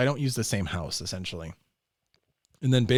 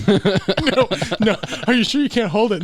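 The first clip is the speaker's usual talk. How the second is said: pleading dad; 10 semitones higher, 10 dB louder than usual. Retold or imitated. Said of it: "He's like".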